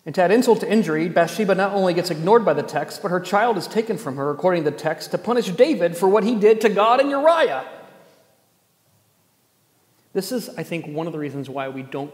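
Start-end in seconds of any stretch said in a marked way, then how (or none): 0.1-7.6 louder, fast, indignant preaching that rises at the end; forceful preaching. Real, not acted. Preacher laying out the injustice to the congregation, forceful. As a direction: preach it hard, pushing louder through the list.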